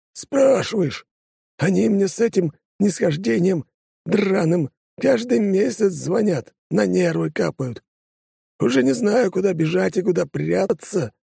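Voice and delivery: a fed-up official griping; harried deputy voice. Acted. Чиновник жалуется на звонки. (раздражённый чиновник жалуется)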